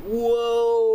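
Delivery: long, high, loud startled whoa. Retold or imitated. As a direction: yelp in drawn-out alarm. Acting out being startled by runner.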